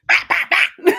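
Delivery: high, loud laughing "bah bah bah"; mock fanfare. Celebrating a comeback, laughing mock fanfare. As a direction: trumpet it through a laugh.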